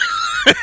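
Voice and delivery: sudden high laughing outburst; a real laugh bursts out high; burst of laughter. Real, not acted. Checking the guy's Facebook, real laughing burst. Laughs reading his posts.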